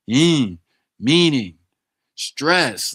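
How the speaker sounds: each word punched loud with a high fall; emphatic list. Shown as a demonstration. Summing up his point to the tutor, emphatic. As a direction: hit every word of "Yin, meaning, stress". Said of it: "emphatic listing".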